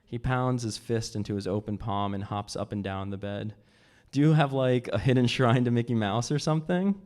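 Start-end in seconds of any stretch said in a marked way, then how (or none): 4.1-7.0 loud, giddy teasing question. A narrator's reading. Giddy man mocking her Disney things, loud and giddy. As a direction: tease her, high on ecstasy. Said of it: "He's giddy with the ecstasy".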